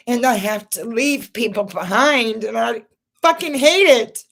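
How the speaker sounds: real crying that bursts out on the last words. Real, not acted. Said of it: "I'm sorry if I'm crying".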